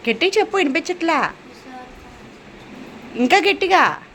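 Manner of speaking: high, insistent mother's reprimand; real scolding. Real, not acted. Mother scolds child, demands louder answer.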